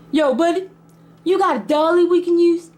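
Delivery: high-pitched imitation of a dad calling over; casual call-out. Dad casually calls to the seller.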